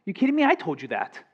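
parent's retort. Retold or imitated. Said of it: "only to hear them say".

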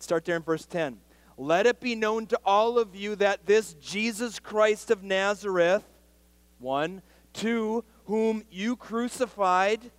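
loud, high-pitched pulpit preaching; fiery preaching. Acted. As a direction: drive the point home to the church. Preaching, reading scripture loudly.

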